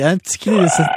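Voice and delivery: ordinary talk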